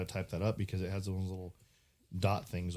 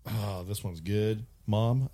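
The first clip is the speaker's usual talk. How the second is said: usual speaking voice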